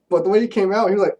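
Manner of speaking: ordinary delivery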